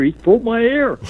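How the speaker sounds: high, whining accusation to the ref; whiny complaint. Retold or imitated. Accusing Bob in front of referee Bill, high and whiny. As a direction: cry foul like a wronged kid.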